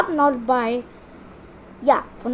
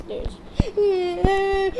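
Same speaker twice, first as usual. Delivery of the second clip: high, drawn-out mock wail; whimpering cry; 6 semitones higher than usual. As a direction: whine as if about to cry. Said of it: "I'm not crying".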